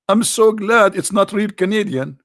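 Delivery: ordinary delivery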